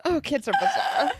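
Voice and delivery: high laughing voice; mother amused by her son's story, laughing. Real, not acted. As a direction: laugh through the words. Laughing after funny story.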